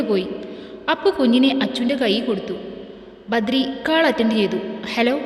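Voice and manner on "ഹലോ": ordinary delivery, nothing marked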